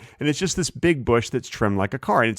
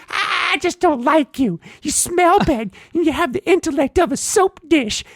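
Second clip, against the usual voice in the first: rude car bush; 4 dB louder than usual. Retold or imitated. Bush insults the driver's face, rude squeaky voice. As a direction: insult in a silly piercing squeak. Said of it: "car bush is so rude to me".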